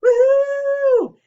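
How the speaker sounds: high, loud woohoo; party whoop; woohoo cheer. Real, not acted. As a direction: whoop with joy. Birthday cheer.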